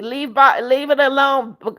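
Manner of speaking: ordinary delivery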